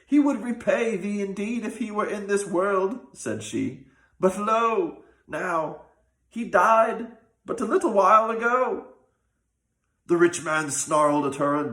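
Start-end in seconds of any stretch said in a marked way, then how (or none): 0.1-3.0 higher, voice of a weeping wife; tearful widow. A narrator's reading. Wife telling the rich man her husband died, tearful. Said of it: "burst into tears". 4.2-8.9 higher, voice of a weeping wife; tearful widow. A narrator's reading. Wife telling the rich man her husband died, tearful. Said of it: "burst into tears".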